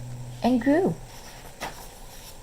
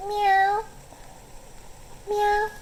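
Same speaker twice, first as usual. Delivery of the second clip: high mewing meow meow; cat meowing; 13 semitones higher than usual. A narrator's reading. Ringo calls Carol to come outside with a high meow. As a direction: mew like a small cat calling.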